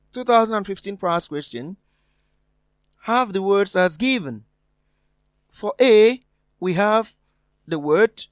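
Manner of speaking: normal talking voice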